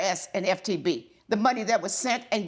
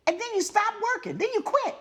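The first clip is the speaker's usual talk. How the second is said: high, pointed scolding questions; scolding tv judge; 10 semitones higher than usual. Real, not acted. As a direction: press him like a stern judge. Judge scolds defendant about quitting.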